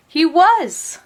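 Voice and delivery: ordinary delivery, nothing marked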